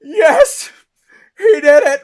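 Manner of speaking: high, loud triumphant crowd cheer. Retold or imitated. Imitating the crowd cheering his win, loud and high. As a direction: shout it like the audience erupting. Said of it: "And everyone's like".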